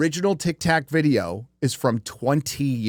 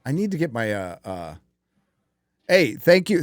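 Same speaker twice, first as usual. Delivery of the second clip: ordinary talk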